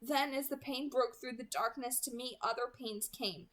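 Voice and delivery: ordinary delivery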